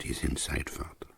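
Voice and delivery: soft unvoiced whisper; woman whispering that the dying man is inside. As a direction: whisper it at the bedroom door. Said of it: "and she whispered to me again".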